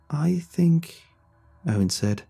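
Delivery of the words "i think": unsure, trailing off; halting, searching for words; hesitant. Acted. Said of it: "a slight hesitation in his voice".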